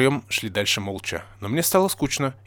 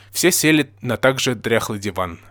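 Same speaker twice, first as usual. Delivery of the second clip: usual speaking voice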